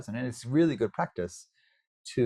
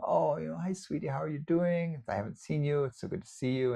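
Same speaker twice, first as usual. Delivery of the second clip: higher, bright hello to a dear friend; fond greeting; 6 semitones higher than usual. Retold or imitated. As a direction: greet an old friend you've missed.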